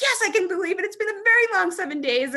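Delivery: a hammed-up exhausted old runner; imitating the race director. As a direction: squeak out his tired reply in a high mock voice. Imitating Dave's weary answer.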